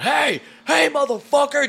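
high shouted hey at a fleeing thief; yelling. Retold or imitated. Taylor chasing the shoplifter out, a high loud yell. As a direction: bellow after the runaway shoplifter.

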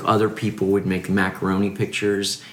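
ordinary talk

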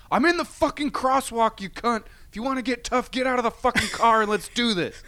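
high, loud road-rage outburst; mock screaming challenge at full volume; retold furious yell. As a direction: re-enact exploding at a driver. Retold outburst at honking driver.

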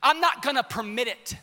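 loud, high, emphatic sermon; full-force preaching. Real, not acted.

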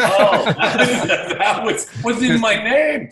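high, loud laughing reply. Retold or imitated. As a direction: laugh through the words as if stung but amused. Laughing at overheard studio put-down.

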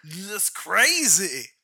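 slow, drawn-out celebrity impression; Randy Quaid. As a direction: stretch out crazy in a put-on voice. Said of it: "We've got Randy Quaid".

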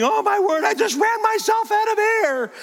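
squealing falsetto, far above his own voice; imitated child's screaming. Grandfather imitating granddaughter after the launch, gleeful screaming. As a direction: scream with glee like a breathless thrill-seeker. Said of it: "She's screaming".

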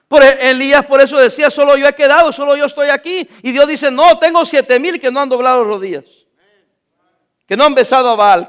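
loud preaching cadence, driving the point home; loud, fervent pulpit delivery; full-force preaching. Acted. Preaching at full force about Elijah.

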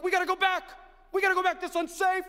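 high, loud panicked shout; mock panic, turn back.

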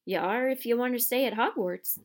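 firm, fierce retort. Acted. Groundskeeper overrules reluctant boy, fiercely. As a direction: lay down the law. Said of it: "said Hagrid fiercely".

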